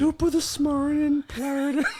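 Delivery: high, garbled Irish greeting; hammed-up bad Irish; mock Irish. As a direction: mangle an Irish accent up high. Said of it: "Please do more Irish imitations".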